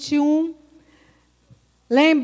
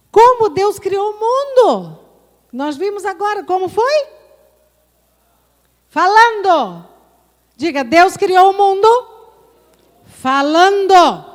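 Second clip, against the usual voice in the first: louder, higher fervent preaching; preaching at full force; 5 semitones higher, 7 dB louder than usual. Acted. Pastor exhorting his congregation to speak their faith. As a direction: preach it with rising fervor. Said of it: "preacher at full force".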